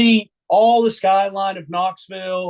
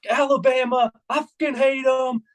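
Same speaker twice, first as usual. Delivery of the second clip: higher-pitched heckling tirade; mock ranting fan; 6 semitones higher than usual. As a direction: let them have it, retelling a buddy's rant. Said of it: "he is just letting them have it".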